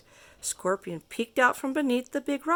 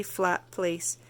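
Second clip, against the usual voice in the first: plain reading voice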